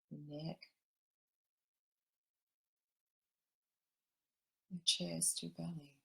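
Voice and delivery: gentle meditation guide drawing everyone into the heart; guided meditation. Acted. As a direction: ease the listeners into calm, unhurried.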